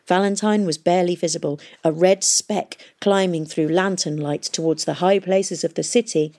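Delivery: plain reading voice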